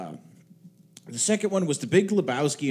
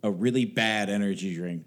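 ordinary talk, nothing marked

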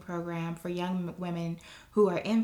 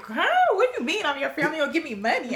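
incredulous questioning; 8 semitones higher, 3 dB louder than usual. Retold or imitated. Student hearing she is now family, incredulous questioning. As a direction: replay your disbelief for laughs. Baffled at being called family.

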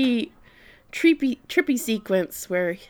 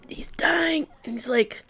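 whispered, higher aside; whispering; 8 semitones higher than usual. Real, not acted. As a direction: breathe the words.

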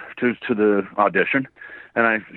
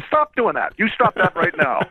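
mock scolding; 6 semitones higher than usual. Mock scolding the dancing Wolverine.

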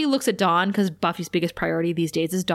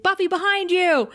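high urgent call-out; warning call; 10 semitones higher than usual. Retold or imitated. Tara warning Buffy of the demon, urgent mock call. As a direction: yell the warning across the shop.

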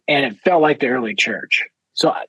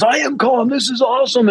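overexcited insisting calm; 9 semitones higher than usual. Retold or imitated. Imitating thrilled teen Josh insisting he is calm. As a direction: gush that you're calm while bursting with joy. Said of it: "He goes".

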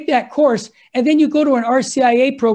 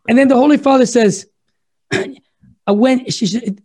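usual speaking voice

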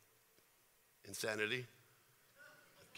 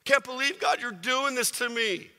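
higher, louder whiny complaint; mock pouting; 8 semitones higher, 6 dB louder than usual. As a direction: sulk loudly at God. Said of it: "He could have been pouting".

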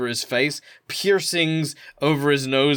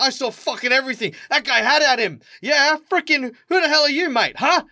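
high, rowdy thugs piling on; angry mates; 12 semitones higher, 4 dB louder than usual. Acted. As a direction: back up your mate with high-pitched aggression. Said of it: "two more of his mates".

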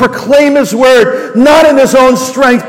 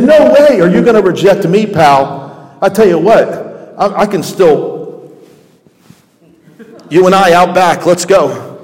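mock tough guy; 4 semitones lower than usual. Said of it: "he could have said".

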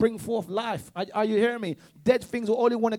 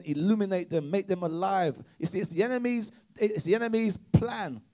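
usual speaking voice

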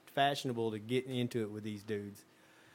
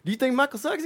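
high, loud mock demand; stern question; 11 semitones higher, 6 dB louder, faster than usual. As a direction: stand over him and dare him to say it again. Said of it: "Steve just looks at the guy and says".

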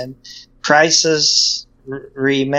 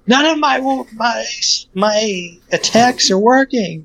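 panicked gamer voice; 8 semitones higher than usual. Retold or imitated. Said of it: "you're like".

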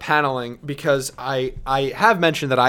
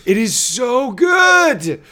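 excited gushing; 6 semitones higher, 4 dB louder than usual. Real, not acted. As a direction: gush about it in awe. Raving over art.

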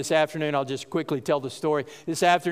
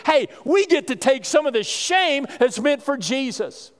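joyful retold quote; 7 semitones higher than usual. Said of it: "now they're rejoicing".

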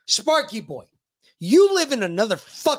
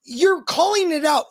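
high, indignant demand; angry rant; 7 semitones higher than usual. Real, not acted. Host demands what the senator does for us, angry and high. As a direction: press each question high and slow.

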